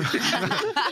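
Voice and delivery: sudden high laugh; high burst of laughter. Real, not acted. Friend cracking up at the tweet joke, high laugh.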